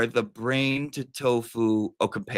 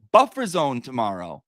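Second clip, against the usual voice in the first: ordinary talk, nothing marked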